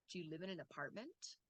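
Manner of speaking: "apartment" swings up at the end; quick, soft question rising on APARTMENT; yes-no question intonation. Shown as a demonstration. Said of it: "yes or no question".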